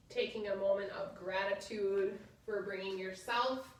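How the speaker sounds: soft, low guided relaxation voice. Acted. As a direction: ease them into rest gently. Leads final relaxation.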